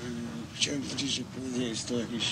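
soft, low, hesitant address; too quiet to follow. Acted. Nervous poet opening his talk to an audience, too quietly. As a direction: mumble it softly and haltingly to the room. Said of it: "niech pan mówi głośniej".

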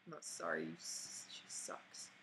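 hushed, voiceless retraction; soft whispered take-back; whispered aside. Real, not acted. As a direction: whisper it under your breath. Muttered retraction of apology about dog.

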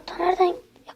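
quick unvoiced whisper. Real, not acted. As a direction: breathe it under your breath. Muttering during tense match.

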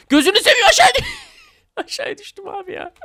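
high-pitched laughing disbelief. Real, not acted. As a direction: laugh it out, cracking up at the fall. Falls down, bursts out laughing. (kahkahalarla gülerek)

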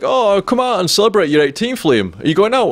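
imitated urging. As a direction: play a mum talking someone into a night out. Mum urging him out, retold.

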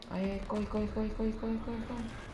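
panicked high yelps of corre; frantic, fleeing in a high voice; being chased. Real, not acted. (correndo em pânico, voz aguda)

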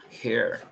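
"Hair" opening on a Spanish J sound; pronounced with J. Shown as a demonstration. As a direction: say "Hair" like "jer", audible first consonant. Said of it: "esto lo pronuncio como con una J".

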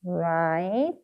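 "Write" stretched, final t set apart; sounding out while writing. Shown as a demonstration. Teacher writing for learners, "Write" sounded out slowly. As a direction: draw out the vowel of "Write", then release the t. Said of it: "써볼게요".

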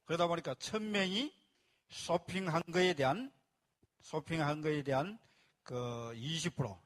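normal talking voice

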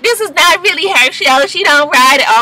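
high, mocking correction; a commenter correcting her, high mock voice; know-it-all commenter. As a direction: mimic a smug commenter. Imitating a commenter.

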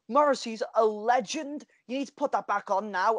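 lightly mock the pushy fan; a hint of the outraged regular; demanding customer voice. Customer demanding the song back.

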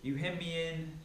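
fervent Bible reading swelling into preaching; scripture reading. Acted. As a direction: proclaim the psalm like a preacher building fervor. Reads Psalm 139 aloud.

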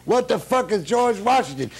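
high-pitched mock outrage; indignant bafflement. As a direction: throw it back, loud and incredulous. Baffled musicians retort in retold quarrel.

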